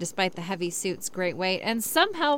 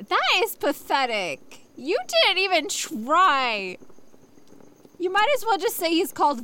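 outraged; 7 semitones higher than usual. Real, not acted. Continuing outraged rant.

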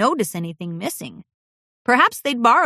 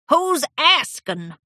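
put-on slow, gruff old pony grumble; grumpy old stallion; 7 semitones higher, slower than usual. A narrator's reading. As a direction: grumble it like a cranky old man. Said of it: "grumbled the old stallion".

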